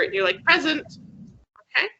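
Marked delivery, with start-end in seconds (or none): 0.4-0.9 "Present" as a roll-call answer, PREZ-ent, pitched high; stress on first syllable. Shown as a demonstration. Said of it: "maybe the teacher asks if you are here".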